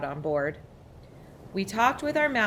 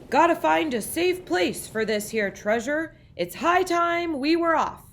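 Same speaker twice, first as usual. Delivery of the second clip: high, louder gruff captain's call; pirate announcement; 9 semitones higher, 4 dB louder than usual. A narrator's reading. Braid Beard tells the crew to sail, hearty announcement. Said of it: "he announced".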